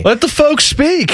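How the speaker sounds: high, slow put-on folksy voice; folksy mock caller voice. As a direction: play a corny fan pleading, drawn out. Mock suggestion from co-host.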